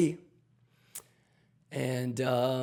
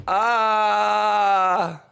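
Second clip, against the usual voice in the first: loud high cry; 6 semitones higher, 6 dB louder than usual. Real, not acted. Wordless cry after taunt.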